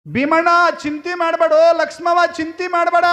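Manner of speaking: high, ringing voice of a guru; loud proclamation. Acted. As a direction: call out reassurance to the couple like a holy man. Guru's proclamation to childless couple.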